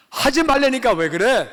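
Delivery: a father losing patience, retold; angry father. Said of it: "아버지가 너무 화가 나셨어요".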